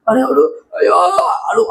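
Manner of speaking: hammed-up wail of a film actor; fake-cry like an actor on cue; mock crying. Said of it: "அழுவோம்".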